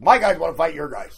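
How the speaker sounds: loud, high mock shout of the sensei; imitating sensei's shout. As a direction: boom the challenge out like the eager sensei. Sensei's surprise challenge, quoted again.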